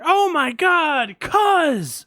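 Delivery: high, loud, slow "Oh my God, cuz!"; overjoyed mock yell across the street; excited shout. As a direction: call out to a long-lost cousin. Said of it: "they'll be like".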